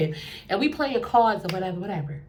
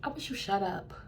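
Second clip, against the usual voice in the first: cold put-down; 2 semitones lower, 11 dB quieter than usual. Retold or imitated. Cold put-down in front of everyone.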